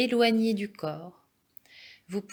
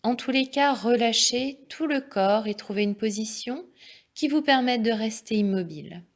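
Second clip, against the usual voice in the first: ordinary talk, nothing marked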